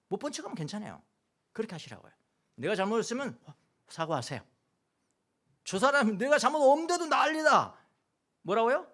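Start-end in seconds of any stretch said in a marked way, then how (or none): 5.6-8.9 mock indignant driver. Voicing upset driver.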